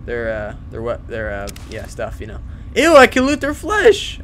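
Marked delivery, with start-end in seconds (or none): 2.8-4.2 high, slow grossed-out; disgusted. Real, not acted. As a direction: recoil in disgust. Disgusted at looting flesh.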